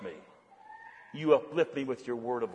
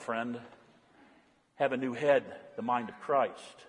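softer, lower, reflective preaching; quiet sermon; 3 semitones lower, 4 dB quieter than usual. Acted. Pastor quietly urging the congregation toward Christ. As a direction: draw them in gently.

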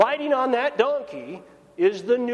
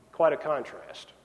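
ordinary delivery

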